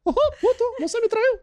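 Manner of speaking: very high squeaky cartoon falsetto; Mickey. Cartoon mouse accusing someone of cheating, squeaky falsetto. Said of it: "É o Mickey".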